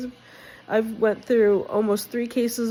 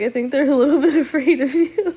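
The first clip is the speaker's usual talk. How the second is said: laughing; 4 semitones higher, 7 dB louder than usual. Retold or imitated. Husband's reply, retold with laughter.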